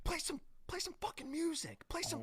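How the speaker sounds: very high, strained soft mock shout; mock yell. Star heckles the band to play music, mock yell. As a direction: imitate a heckler yelling, volume held back. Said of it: "about to, like, yell".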